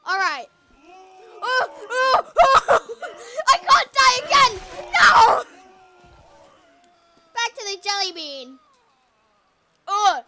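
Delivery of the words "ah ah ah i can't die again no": panicked screaming. Real, not acted. Dying in game again.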